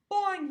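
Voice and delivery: high, loud twangy boing; springy cartoon boing; frog twang boing. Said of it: "he twanged".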